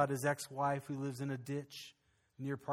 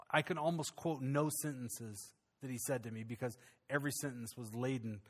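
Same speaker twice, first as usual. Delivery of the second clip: normal talking voice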